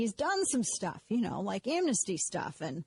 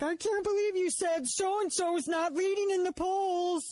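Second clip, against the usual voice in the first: much higher, slow whiny outrage; mocking emailers; 10 semitones higher than usual. Host mocks angry listeners who email her, high whiny put-on. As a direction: imitate an indignant emailer, drawn out and high. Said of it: "people who are like".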